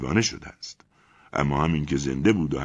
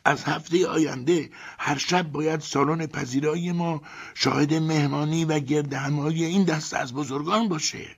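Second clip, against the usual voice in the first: higher, eager Ivan dreaming of fame; excited Ivan; 10 semitones higher than usual. A narrator's reading. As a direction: gush about the parties to come. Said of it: "با همان هیجان ادامه داد".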